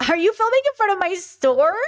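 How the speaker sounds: imitating a fake-sweet, prying shopkeeper; passive aggressive. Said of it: "She did the passive aggressive".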